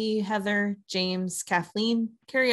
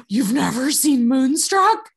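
higher, louder and slow, aghast; shocked disbelief; 6 semitones higher, 8 dB louder, slower than usual. Retold or imitated. To roommate who never saw Moonstruck, slow scandalised disbelief. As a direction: act scandalised that she missed it.

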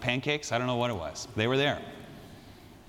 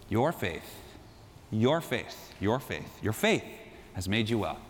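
tender blessing; 4 semitones lower, 4 dB quieter than usual. Acted. Jesus speaks tenderly to the woman.

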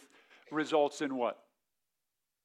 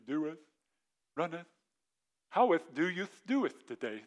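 playfully mocking "Doeth", "runneth", "Howeth" as fake old English; a light parody of King James English; mock archaic speech. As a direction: tease the old-fashioned talk with a light archaic lilt. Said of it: "they didn't talk like that".

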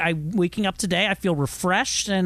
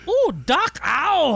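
high strained ow; mock pained yelp; 8 semitones higher, slower than usual. Retold toilet strain.

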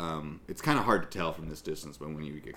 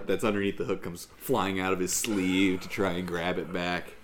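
ordinary delivery

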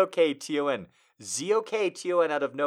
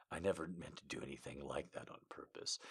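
soft low whisper; 9 semitones lower, 20 dB quieter, faster than usual. Acted. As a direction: breathe it like a secret. Whispered character line.

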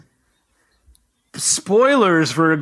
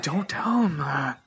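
secretive stage whisper; 7 dB quieter than usual. Acted. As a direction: hush it like a secret. Conspiratorial aside to co-host.

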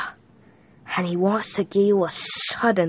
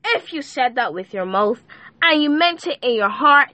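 usual speaking voice

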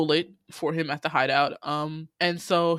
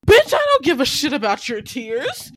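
higher, louder scolding with a sob in it; mock angry outburst; 7 semitones higher, 5 dB louder than usual. Telling off a crying man, mock furious and teary. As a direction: snap it out like you're done with their tears.

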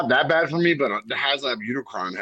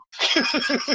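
laugh. Real, not acted. Laughing at fan argument.

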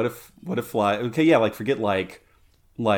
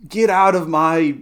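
loud, high ogre bellow, drawn out slowly; Shrek; 7 semitones higher, 9 dB louder, slower than usual. Acted. Imagining Japanese Shrek for a co-host, ogre bellow. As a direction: bellow it like an angry cartoon ogre. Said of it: "an adaptation of Shrek to a Japanese audience".

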